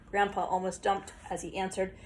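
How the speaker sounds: plain reading voice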